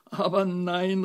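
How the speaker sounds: loud, high desperate protest. A narrator's reading. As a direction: cry out in exasperation. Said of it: "rief die Fee verzweifelt".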